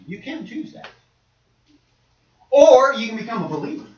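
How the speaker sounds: ordinary talk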